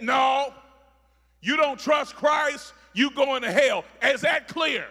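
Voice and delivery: loud, climbing preacher shout; full-force sermon delivery; preaching at full force. Real, not acted. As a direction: thunder it over the congregation.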